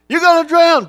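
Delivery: high, loud panicked yell, repeated; grandmother screaming. Retold or imitated. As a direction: shriek the warning twice in alarm.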